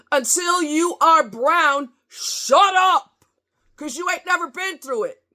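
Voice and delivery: high and slow, then a yelled "shut up"; angry outburst. Real, not acted. Ranting at a coworker, high, slow, then yelling. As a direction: lay into him, rising to a yell.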